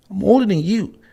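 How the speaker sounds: usual speaking voice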